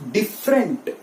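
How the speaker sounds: 'डिफरेंट' in two syllables, dif-rent; right pronunciation. Shown as a demonstration. Teacher revealing the right pronunciation, silent middle vowel. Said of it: "इसका right pronunciation है".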